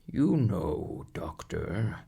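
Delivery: slow, worn-out plough horse voice; a weary old horse grumbling about his vet. A narrator's reading. Plough horse complaining to the doctor, slow horse voice.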